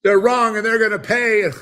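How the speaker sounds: a stubborn man yelling as his house burns, high and loud; ranting deluded man. Retold or imitated. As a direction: ham up a shrill furious rant from a burning house. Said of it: "As you're burning in your house".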